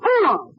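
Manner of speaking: very high, sharp "boom!"; explosion imitation. Fan acting out the tank blowing up, high mock explosion. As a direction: burst out with the blast sound.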